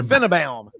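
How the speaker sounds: Finebaum said as FIN-a-bam; Mumbles. Retold or imitated. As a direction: imitate a caller's three-syllable Finebaum, FIN-a-bam. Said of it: "I hear this guy going".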